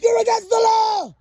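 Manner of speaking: high, loud yell of an angry man; mock shout. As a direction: yell it at her like an accusation. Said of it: "He's like".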